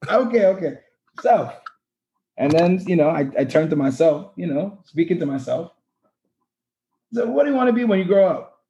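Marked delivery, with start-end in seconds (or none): 1.2-8.6 put-on a clumsy Caribbean accent pitched high; attempted Caribbean accent. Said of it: "it's not a good accent".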